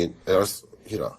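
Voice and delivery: ordinary delivery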